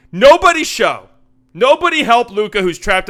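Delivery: loud, high, sarcastic call; mock coaching shout. Commentator mocking defenders who leave Luka trapped, sarcastic shouting. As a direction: call it out like a mock order to the team.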